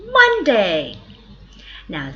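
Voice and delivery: normal talking voice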